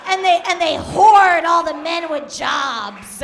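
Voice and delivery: slow, loud, almost shouted complaint; loud slow mock outrage; exasperated outburst. Real, not acted. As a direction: lean hard into the gripe. Exasperated rant about women.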